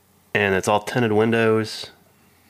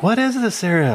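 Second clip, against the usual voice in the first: put-on raised pitch, puzzled older lady; mock lady voice; 10 semitones higher than usual. Retelling the lady at the hunting area, high puzzled voice. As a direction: lightly play the confused lady. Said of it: "she's like".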